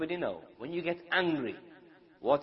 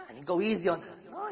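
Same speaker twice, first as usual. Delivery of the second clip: ordinary talk, nothing marked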